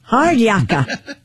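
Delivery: very slow put-on Aussie; Australian accent. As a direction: drag it out Aussie style. Reading Aussie slang aloud.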